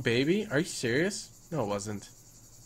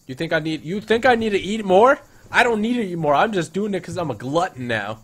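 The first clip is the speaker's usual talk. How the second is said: high, loud playful boasting; 6 semitones higher, 7 dB louder than usual. Pretend. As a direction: brag about eating too much. Playful gluttony bragging.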